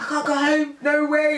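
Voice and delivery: high, loud wail; hammed-up pleading not to leave; mock distress. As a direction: act out panicked refusal. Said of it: "I just burst into tears".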